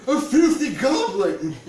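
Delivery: high, loud, slow shrieking farmer; loud high farmer's shriek; shrieked disgust. A narrator's reading. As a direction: screech it in horror. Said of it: "shrieked the farmer".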